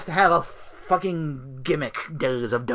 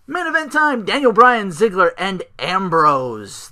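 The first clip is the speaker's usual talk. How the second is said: higher, louder ring-announcer hype, stretching the last names slowly; announcer call; 7 semitones higher, 7 dB louder, slower than usual. Pretend. Announcing the main event.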